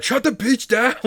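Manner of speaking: high, loud hammed-up shout; exasperated mock yell. Fed-up viewer to sleazy mayors, mock yelling.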